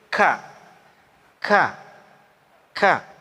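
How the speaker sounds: "Ka" with a slightly bursting, airy k; breathy kaf. Shown as a demonstration. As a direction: release "Ka" with a little burst of air. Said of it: "Sedikit pecah". ("Ka" dibaca sedikit pecah)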